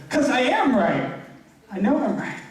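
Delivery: mock defensive insistence. Preacher replays his retort to his life coach, mock defensive. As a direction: act out stubbornly insisting you're right.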